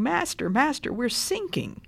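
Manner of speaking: high, panicked cry for help. A narrator's reading. Disciples waking Jesus in the sinking boat, high panicked cry. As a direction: cry out to wake him, pitched high.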